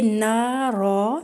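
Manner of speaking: نا را slow with both alifs drawn out equally; pausal long a. Shown as a demonstration. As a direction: stop on نا را, stretching both vowels evenly. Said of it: "دونوں same لمبا کرنا ہے". (نا را وقف کے ساتھ دونوں برابر لمبا)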